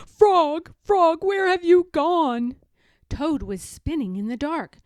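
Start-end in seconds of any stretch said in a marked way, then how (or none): 0.1-2.5 desperate call. Acted. Toad calling desperately.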